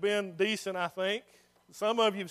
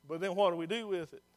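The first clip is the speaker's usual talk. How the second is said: ordinary talk, nothing marked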